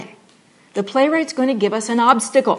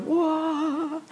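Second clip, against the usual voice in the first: stunned whoa; 7 semitones higher, 5 dB quieter than usual. Retold or imitated. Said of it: "And you're like".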